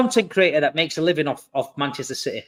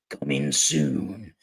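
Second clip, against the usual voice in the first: very low, drawn-out trailer announcer; movie trailer voice; 9 dB quieter, much slower than usual. Retold or imitated. Host mimics a film trailer to the panel, deep slow announcer voice. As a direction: intone it like a cinema trailer voiceover. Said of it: "that voice comes on".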